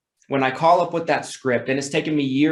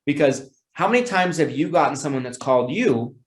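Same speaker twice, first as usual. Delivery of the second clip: usual speaking voice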